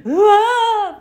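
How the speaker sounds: high, loud cry of うわー; a manga-style gasp of shock. Retold or imitated. A woman imitating her parent reacting to her bruise. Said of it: "って言うから".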